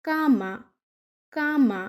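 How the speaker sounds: কামা with a hard ক sound at the start, modelled for learners; Spanish word cama. Shown as a demonstration. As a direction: say কামা clearly, c as k. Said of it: "সে এর উচ্চারণ হচ্ছে ক এর মতো".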